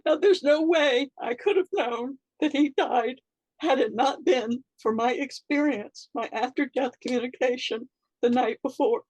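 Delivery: choked up for real; tearful. Real, not acted. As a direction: near tears while explaining. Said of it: "I'm gonna get choked up".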